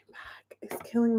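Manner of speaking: whispered, no voice at all. Real, not acted. Whispering to viewers about her aching back. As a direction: breathe it out as a pained whisper.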